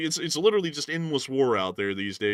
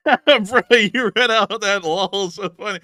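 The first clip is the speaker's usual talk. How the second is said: laughing; 8 semitones higher, 11 dB louder, slower than usual. Real, not acted. Laughing at the reading.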